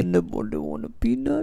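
low giant voice through laughter; laughing as the giant; Andre the Giant voice. Retold or imitated. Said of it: "That's funny".

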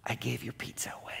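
a sheepish whisper of the bad news; whispered confession. Pizza guy to the pastor, whispering the bad news. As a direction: breathe it out like you're afraid to admit it. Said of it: "the guy looked at me and he goes".

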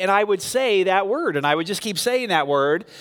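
ordinary talk, nothing marked

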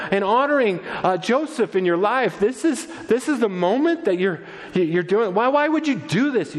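high-pitched, fretful reproach; exasperated scolding. Acted. Worried mother scolding twelve-year-old son, exasperated. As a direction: scold in disbelief, words stumbling out.

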